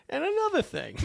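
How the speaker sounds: put-on high, grumbling old-man complaint; a hint of a cranky old man; grumpy old man. Shown as a demonstration. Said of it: "I sound like a grumpy old man".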